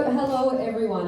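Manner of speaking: usual speaking voice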